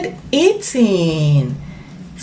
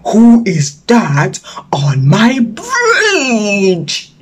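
very slow, menacing crocodile voice; slow crocodile growl; much slower than usual. Acted. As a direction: drag it out like a big grumpy beast. Crocodile asks again.

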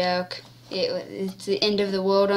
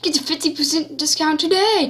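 ad voice; 5 semitones higher, faster than usual. Pretend. Mock ad read.

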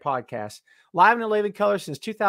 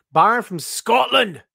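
put-on Scottish accent; 4 semitones higher, 4 dB louder than usual. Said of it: "I'm sure I'm butchering your accent".

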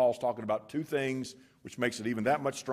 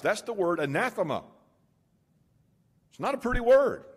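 raised, ringing pulpit voice; preaching at full force; 8 semitones higher, 4 dB louder than usual. Acted. Preacher proclaiming scripture to the congregation, full force. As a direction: proclaim each line to the congregation. Preacher builds on Paul's words.

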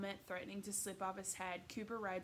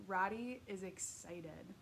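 ordinary delivery

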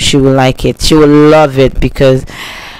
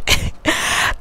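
put-on breathy baby giggle; 11 dB quieter than usual. Laugh in baby voice.

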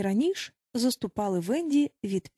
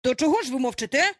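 quick, higher impatient demand; growing annoyance; 4 semitones higher, 4 dB louder, faster than usual. A narrator's reading. Peter questions the silent boys, annoyed and quick. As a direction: demand an answer fast, getting cross. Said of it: "уже починаючи сердитись".

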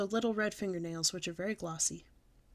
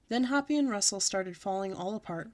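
ordinary delivery, nothing marked

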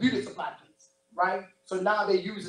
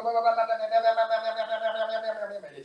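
mimicking endless chatter; 3 semitones higher than usual. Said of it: "Just how it sounded".

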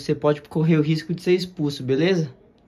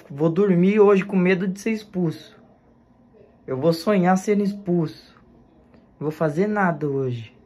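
ordinary talk, nothing marked

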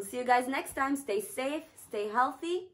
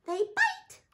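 very high, squeaky chimp voice; 11 semitones higher, 3 dB louder than usual. Acted. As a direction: chatter it like an excited chimp. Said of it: "replied the chimp".

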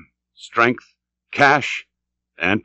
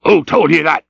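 loud, higher demand; captain's raised voice; sharp cry; 5 semitones higher, 5 dB louder than usual. Acted. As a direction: challenge the mate with a cry. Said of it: "cried Ahab".

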